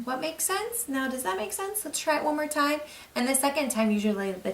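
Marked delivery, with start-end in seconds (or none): 0.0-2.8 gentle prompting. Shown as a demonstration. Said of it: "try that word again".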